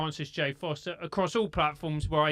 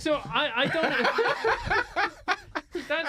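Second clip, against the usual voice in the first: higher, words lost in laughter; laughing; 11 semitones higher than usual. Real, not acted. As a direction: tries to speak but laughs. Trying to talk, laughing.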